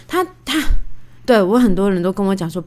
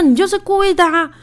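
higher, louder mock accusation; accusing retort; 10 semitones higher, 3 dB louder than usual. Wife accusing husband of slapping on purpose, mock angry. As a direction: throw the blame back at him.

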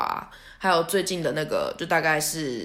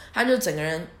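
usual speaking voice

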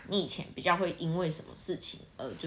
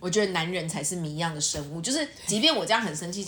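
ordinary delivery

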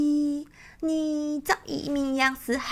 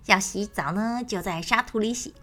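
lower, softer bird voice instructing; a hen quietly warning her chicks; mother bird; 4 semitones lower, 6 dB quieter, much faster than usual. Acted. Lakanga telling her children to avoid the river, softer.

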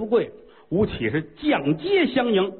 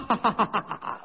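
gleeful laugh; 4 semitones higher, 5 dB quieter than usual. Acted. Emperor laughs after fake mourning.

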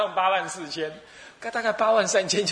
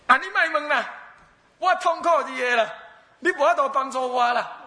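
hammed-up wailing; 8 semitones higher than usual. Old man wails at the Buddha to leave him alone. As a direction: whimper it like a crying old man. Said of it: "他就哇哇哇努力在那哭".

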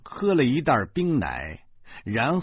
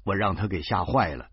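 usual speaking voice

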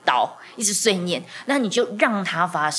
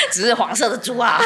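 laughing tag line; 4 semitones higher than usual. Real, not acted. Laughing at own joke.

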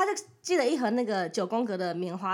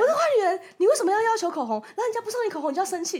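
mock accusation; 8 semitones higher, 4 dB louder than usual. 模仿网友指责自己.

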